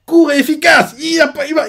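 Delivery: high, loud exclamation; excited. Real, not acted. Fan praising the speech, excited shout. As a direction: burst out with admiration.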